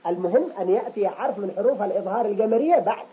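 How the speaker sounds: ordinary talk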